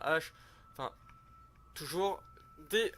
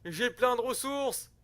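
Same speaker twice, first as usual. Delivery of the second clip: much higher, slow, delighted brag; gleeful boast; 10 semitones higher, 8 dB louder, much slower than usual. Real, not acted. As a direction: stretch it out, thrilled with your riches. Delighted at his own stockpile.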